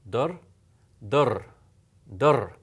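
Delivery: Dur held long and stressed; lengthened sound. Shown as a demonstration. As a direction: draw out each Dur. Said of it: "I'm going to lengthen that sound".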